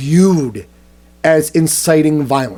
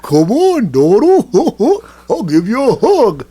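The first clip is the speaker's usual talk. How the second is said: higher, cooing mascot voice; children's show mascot; 7 semitones higher, slower than usual. Acted. Mascot inviting Trump to a hug, higher cooing voice. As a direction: offer a hug like a kids' TV mascot.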